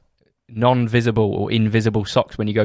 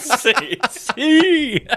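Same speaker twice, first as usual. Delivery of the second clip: exaggerated "C". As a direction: shout "C" like a gym lifter. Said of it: "saying "C".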